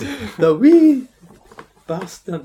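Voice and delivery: high, loud comic Scottish; hammed-up Scottish accent; mock Scottish accent. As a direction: say wee bastard like an angry Scot. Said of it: "Scottish accent".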